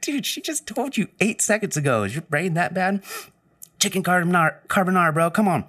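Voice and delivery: high, loud incredulous scolding; mock scolding a stoned coworker; exasperated. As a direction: scold a clueless friend.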